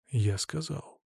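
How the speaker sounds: soft but firm rebuff; curt cut-off. A narrator's reading. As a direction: cut him off quietly. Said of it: "отрезал я".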